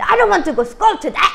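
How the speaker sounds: put-on high, loud, slow whine from a sulking child, half laughing; whiny child protest. Mom imitating her daughter sulking at the table. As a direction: drag it out like a defiant little girl. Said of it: "이렇게 얘기하면서 앉는데".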